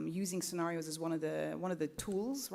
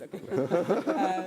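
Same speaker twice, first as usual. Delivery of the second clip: laughing; 10 dB louder than usual. Real, not acted. Laughing at the face on screen.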